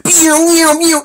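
high, loud "pew" sound effects; imitated zooming noises. Reader adding effects as the car speeds through, high zooming noises. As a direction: fire off cartoon whooshes.

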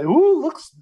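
imitating a host eagerly spotting something; mock intrigued ooh. Guest mimics host returning to the dossier story, mock intrigued. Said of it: "she kept going back at it and say".

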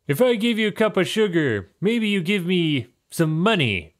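high, grudging bargaining voice; begrudging old man. Acted. Old neighbor bargains with the visitor, grudging high voice. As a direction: haggle reluctantly. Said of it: "looks at you begrudgingly".

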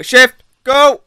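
very high, short urgent cries; high-pitched panicky urging; urgent command. Real, not acted. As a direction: squeal orders in a rush to escape. Urgent commands during escape.

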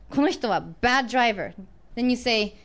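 ordinary delivery

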